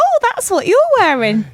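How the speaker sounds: high, loud mock discovery; teasing, high-pitched "oh!"; mock realization. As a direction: exclaim it in hammed-up surprise.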